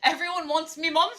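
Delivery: high, loud cartoon crab captain; Mister Krabs voice. Reading a line aloud in a hammed-up Mister Krabs voice. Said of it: "that was Mister Krabs".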